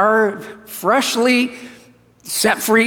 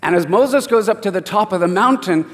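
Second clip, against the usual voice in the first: ordinary talk, nothing marked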